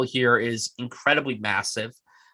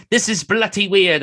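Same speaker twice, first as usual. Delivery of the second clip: a light mock Cockney accent, pitched up; 8 semitones higher, 4 dB louder than usual. A podcaster imitates Don Cheadle's Cockney accent, lightly mocking. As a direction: toss off a quick imitated Cockney line. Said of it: "I love his very Cockney accent".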